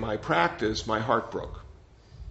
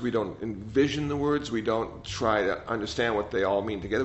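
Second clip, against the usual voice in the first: ordinary talk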